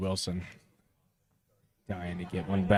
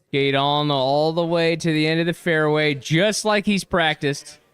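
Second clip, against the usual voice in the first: loud, lifted play-by-play call; excited; 7 semitones higher, 8 dB louder than usual. Real, not acted. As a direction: sell the drive. Live call of drive.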